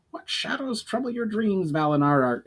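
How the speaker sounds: high, curious goblin voice. A narrator's reading. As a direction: pitch up into a wary little goblin. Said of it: "inquired Urgar".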